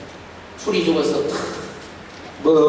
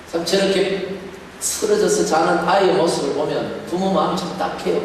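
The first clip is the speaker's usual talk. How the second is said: ordinary talk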